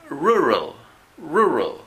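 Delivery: "rural" with both Rs untrilled, English style. Shown as a demonstration. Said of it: "No, rural". (erres inglesas en "rural", sin vibrar)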